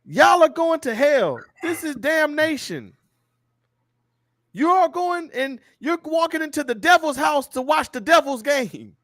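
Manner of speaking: high-pitched, drawn-out hellfire condemnation; megaphone street preacher. Retold or imitated. Preacher condemning fans walking into the game, high mock hollering. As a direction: proclaim doom like a preacher on a megaphone. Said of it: "this is the Texans guy".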